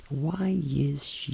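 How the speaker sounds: very slow, soft words; intimate voice. Shown as a demonstration. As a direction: stretch it out softly. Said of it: "tem que usar a voz íntima". (voz íntima, bem lenta e baixa)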